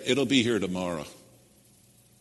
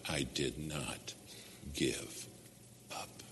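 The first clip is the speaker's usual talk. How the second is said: fading whisper; 3 semitones lower, 12 dB quieter, slower than usual. Real, not acted. Sermon climax, fading testimony.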